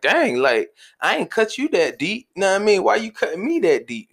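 high-pitched mock complaint; acting out wounded disbelief; hurt protest. Acting out his hurt protest to wife.

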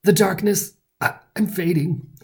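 high, halting death-scene wail. A narrator's reading. Hero fakes dying before the gang, melodramatic wail. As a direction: ham up a dying speech with pauses. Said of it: "wailed Johnny".

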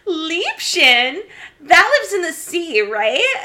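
high, loud airhead asking dumb question; ditzy character voice. Retold or imitated. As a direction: play the dumb actress, bright and clueless. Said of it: "my character goes".

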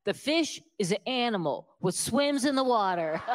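put-on explaining slowly, as to a child; slow and careful. Waitress explains fish to the snobby customer, slow and careful. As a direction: spell it out so a fool can follow. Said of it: "kind of slow and careful so I can understand it".

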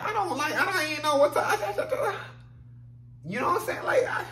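high, loud, laughing and lost for words; laughing disbelief. Real, not acted. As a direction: laugh at how absurd it is.

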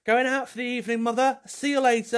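high, prim going-out voice; posh mock mum. Nan in old photo tells her mother she's going out, high and prim. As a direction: trill it like a lady off for the evening.

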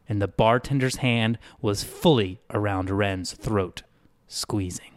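plain reading voice

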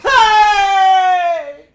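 sudden piercing high scream of a greeting; startling yell. Pretend. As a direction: burst in with a very loud, high, held yell. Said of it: "He scared the fuck out of me".

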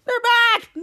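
high, loud panicked cry; freaking out. Retold or imitated. Henry leaping away from the hornets, freaking out. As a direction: yell it in acted alarm. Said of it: "Henry's freaking out".

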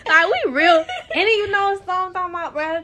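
興奮して笑いながら; excited. Real, not acted. Excited laughing banter.

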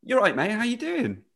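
high, friendly footballer greeting; lightly voiced friendly footballer; imitating Conor Coady. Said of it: "he goes".

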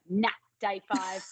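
slow, cheeky nah; mock defiant shrug in the voice; cheeky dismissal. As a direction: brush the advice off with a grin. Retold cheeky refusal to wait.

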